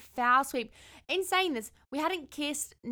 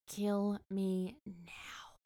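deadpan dread; 6 dB quieter, much slower than usual. Retold or imitated. Cringing in bed beside a date, slow deadpan. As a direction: drag out each word in quiet mortification.

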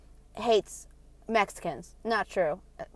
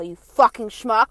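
loud, slow, drawn-out insult; angry retort; 9 dB louder, slower than usual. Retold or imitated. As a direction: hurl the retold insult loudly and slowly. Retold retort to rude bartender.

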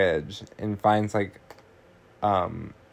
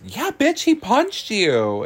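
much higher, sassy and slow; sassy reply; 12 semitones higher than usual. Retold or imitated. Maddie confirms the breakup to Tracy, high and sassy. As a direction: throw it at her with attitude.

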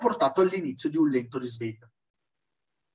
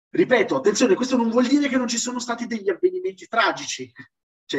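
ordinary talk

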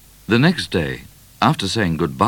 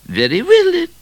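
regal queen voice; 7 dB louder than usual. A narrator's reading. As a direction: speak as a haughty queen. Queen speaks.

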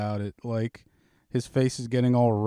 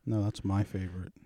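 normal talking voice

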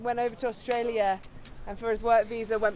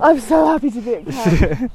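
excited; 13 dB louder than usual. Real, not acted. Cuddly excited camp exclamation.

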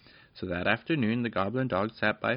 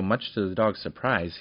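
usual speaking voice

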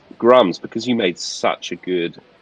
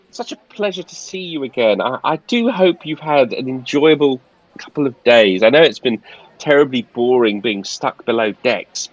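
high, polite steward voice; posh steward voice; 7 semitones higher than usual. Acted. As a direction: play the fussy, polite steward. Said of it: "and he says".

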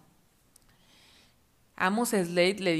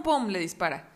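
plain reading voice

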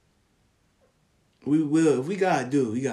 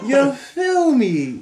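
high loud laughing "You feel me?"; slow, high giggling question; 8 semitones higher, 8 dB louder, much slower than usual. Real, not acted. Laughing after the joke.

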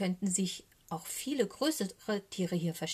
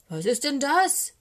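loud, high indignant owl; outraged shout of a startled owl; angry scolding; 7 semitones higher, 9 dB louder than usual. A narrator's reading. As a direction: burst out crossly. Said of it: "schimpft die Eule".

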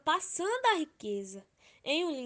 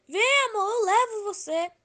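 calling out; 8 semitones higher, 7 dB louder than usual. Acted. Old man calls to Love.

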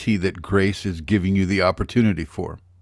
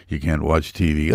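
monotone voice; 7 semitones lower than usual. Retold or imitated. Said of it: "going on with a monotonous voice".

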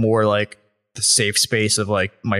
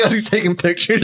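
ordinary talk

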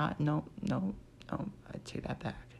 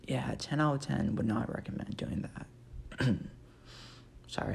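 normal talking voice

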